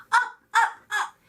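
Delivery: high, loud climbing call; rising excitement. A narrator's reading. Narrator cheering the engine up the mountain, high and loud. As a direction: climb with each word.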